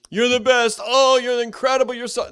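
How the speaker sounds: high, loud over-the-top praise; gushing flatterer. Retold or imitated. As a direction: gush like a flatterer. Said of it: "A flatterer is a bad thing".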